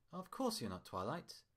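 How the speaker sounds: lightly mock-humble, a touch condescending; mock humbleness. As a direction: play humble but don't mean it. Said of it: "he said with mock humbleness".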